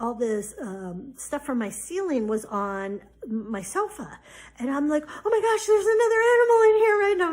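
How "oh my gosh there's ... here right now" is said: high panicked shriek; loud, high, fast frightened cry; freaking out. Retold or imitated. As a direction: squeal in terror at the thought of mice. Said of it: "I'm freaking out".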